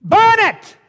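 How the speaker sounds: very high, loud command; shout. Acted. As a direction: scream the order to the crowd. Climax of exhortation.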